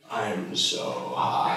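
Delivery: slow, hushed stoned confession; stoned whisper. Retold or imitated. Confiding to cheerleader while high.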